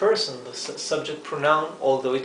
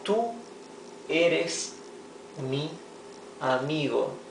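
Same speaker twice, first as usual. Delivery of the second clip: slow word-by-word reading; 4 dB quieter, slower than usual. Shown as a demonstration. Teacher reading an example to students word by word. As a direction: spell out the sentence slowly for learners. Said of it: "teacher reads example word by word".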